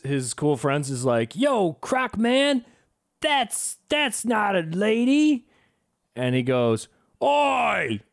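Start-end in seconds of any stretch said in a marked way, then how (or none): 1.3-5.5 higher, drawn-out call to a pal; cool friend. Retold or imitated. Acts the friend warning Dundee. 7.2-8.0 higher, sudden "oi"; mock outburst. Acts Dundee's outburst in bar.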